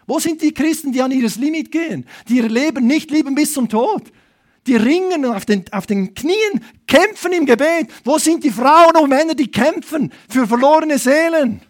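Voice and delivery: fervent revival-preacher call; impassioned preaching. Real, not acted. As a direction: preach it with rising urgency. Preaches at full force.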